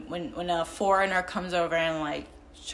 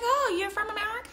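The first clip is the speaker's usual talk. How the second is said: much higher, quick curious query; mock surprised question; faster than usual. People talking to her ask her origin, mock surprised. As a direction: imitate someone surprised, pitched way up. Voices people asking where she's from.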